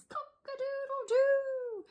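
high, slow cock-a-doodle-doo crow; rooster crow. Acted. The rooster dad crowing to wake the farm. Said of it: "he says".

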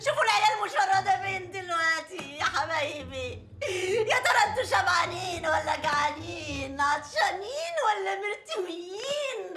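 hammed-up sobbing lament for the children; theatrical crying with laughter breaking through; overdone weeping. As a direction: wail it like a soap opera mother. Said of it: "كده اوفر يا كاميليا".

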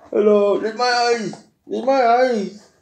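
high, slightly slow squeaky little voice; squeaky toy voice. Acted. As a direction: speak as the sparkly toy tree. Toy tree speaking to child.

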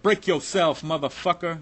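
loud, high menacing bark; sudden loud street threat; retold robber yell. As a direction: bark it like a gunman at the window. Armed robber demanding at gunpoint.

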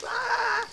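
put-on high childish tantrum wail. Imitating a kid's tantrum, a high wail. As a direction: wail like a little kid throwing a fit.

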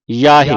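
loud ਯਾਹੇ starting on a true y glide; clear Y onset. Shown as a demonstration. Teacher models the y letter for a student, ਯਾਹੇ with clear "y". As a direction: say ਯਾਹੇ as "yaa-he". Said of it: "ਜਈਏ ਦੀ ਆਵਾਜ਼ ਉਚਾਰਨ ਕਰਨੀ".

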